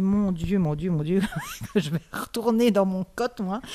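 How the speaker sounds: half-laughing replay of her own panic; a touch of comic terror while retelling; mock panic. Retold panic, fleeing to dorm.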